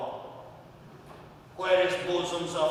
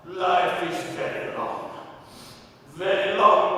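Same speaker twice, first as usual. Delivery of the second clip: ordinary talk, nothing marked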